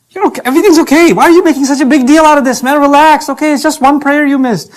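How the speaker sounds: high, light, dismissive tempter; coaxing devil voice. Acted. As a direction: devil soothing a sinner with false hopes, high. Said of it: "False hopes".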